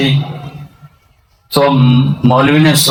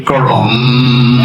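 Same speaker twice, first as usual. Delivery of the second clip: imitated thud; much slower than usual. Cleric's voice in story.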